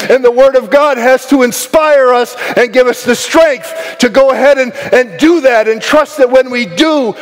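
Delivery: high-energy sermon, ringing out promises; fervent preaching. Real, not acted. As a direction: preach it with rising conviction and punched key words. Preaches at full force.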